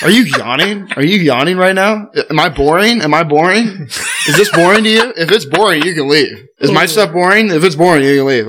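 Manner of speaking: mock scolding of a yawning player; ripping a kid. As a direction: pepper them with offended questions, pitched high. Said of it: "I ripped a kid tonight".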